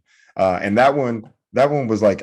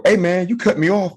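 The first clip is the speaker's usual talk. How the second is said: high-pitched comic confrontation; mock tough guy; 10 semitones higher than usual. Driver shouting across to who cut him off, mock tough. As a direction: call out the other driver, acting tough. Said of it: "trying to be a tough guy".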